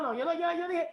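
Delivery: usual speaking voice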